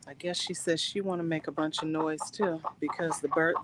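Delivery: ordinary talk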